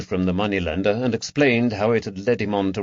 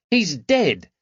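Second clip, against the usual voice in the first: put-on high boy's voice, exasperated; a lad stating the obvious, irritated; annoyed boy; 9 semitones higher, 4 dB louder than usual. A narrator's reading.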